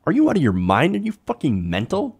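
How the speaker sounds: ordinary delivery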